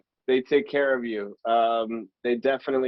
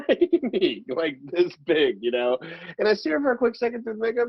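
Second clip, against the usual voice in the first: normal talking voice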